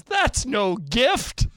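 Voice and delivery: high, drawn-out imitation of the coach; retold protest. Coach seeing the giant wreath, mock protest. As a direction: stretch it out high, mock-objecting.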